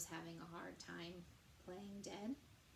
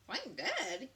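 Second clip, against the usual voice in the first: louder astonished question; surprised cry; 4 semitones higher, 7 dB louder than usual. Acted. Startled bear crying out at Joe. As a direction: gasp it out in disbelief. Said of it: "he cried".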